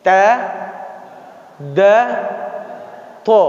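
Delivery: ta, da, to set side by side; contrasting letters. Shown as a demonstration. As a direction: show the difference in quality. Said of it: "membedakan adalah sifatnya".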